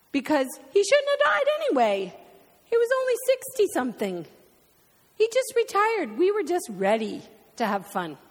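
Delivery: high, loud, voice breaking with grief; real tears pushing through angry words; tearful outburst. Real, not acted. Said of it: "You just saw it happen with me".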